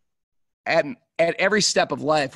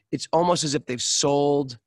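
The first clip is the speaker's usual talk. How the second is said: ordinary talk, nothing marked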